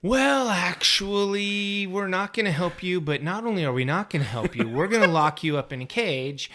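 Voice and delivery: mock-apologetic, drawn-out refusal; Niobe. Podcaster voicing a leader refusing to help, slow mock condescension. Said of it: "And Niobe's like".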